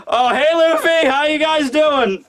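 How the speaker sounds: high, stretched-out, put-on cheery hello; strained greeting. Sick samurai greeting his captain friend, strained. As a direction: force out a slow, high greeting.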